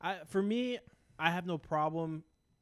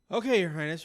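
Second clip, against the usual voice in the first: mock deference; 2 semitones higher, 9 dB louder than usual. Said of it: "Refer to me as Your Highness".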